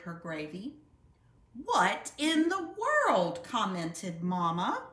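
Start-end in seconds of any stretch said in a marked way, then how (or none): 1.5-3.4 surprised. Acted. Mama startled by the volcano.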